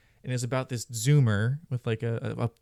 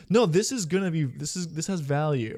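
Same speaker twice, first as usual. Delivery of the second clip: higher-pitched imitation of a true believer; mock-earnest protest, pitched up at the start; mock insistence; 4 semitones higher than usual. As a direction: insist like a deluded investor, mockingly. Mimicking NFT believers' insistence.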